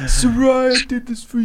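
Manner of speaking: very high, tearful-sounding champion's speech; high emotional victory dedication. Pretend. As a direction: dedicate the win, voice soaring high. Rehearsing the victory shout-out.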